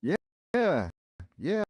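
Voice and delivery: calling back. A narrator's reading. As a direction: call back from across the room. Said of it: "he called back".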